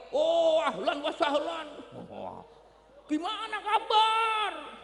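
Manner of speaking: lightly voiced fawning welcome, laughing; a hint of a gushing cleric greeting the rich; mock oily welcome to a rich guest; mock fawning host. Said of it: "Kalau tamunya datang bermobil".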